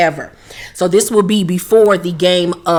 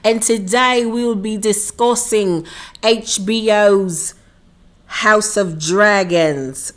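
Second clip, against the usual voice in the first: put-on horrible London accent; 2 semitones higher than usual. Vlogger greeting her sweethearts in a mock London accent. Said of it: "enough of my horrible London accent".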